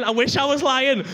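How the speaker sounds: ordinary talk, nothing marked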